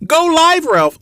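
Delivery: the viewer finally yelling the request; shout. Shown as a demonstration. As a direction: call it out so he can hear.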